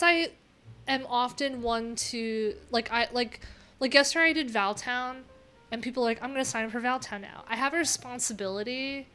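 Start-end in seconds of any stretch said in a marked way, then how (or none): none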